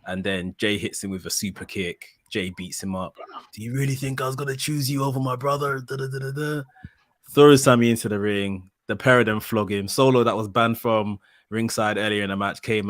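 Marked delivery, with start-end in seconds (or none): 3.5-6.6 a hint of a taunting Jay; higher, mocking betrayal line; voicing Jay. Voicing Jay turning on Sammy.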